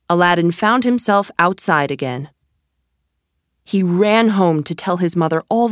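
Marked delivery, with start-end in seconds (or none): none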